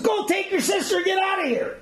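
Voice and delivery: high, urgent send-off; rattled dismissal. Retold or imitated. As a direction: act a spooked officer shooing them out. Rattled commandant sends them away.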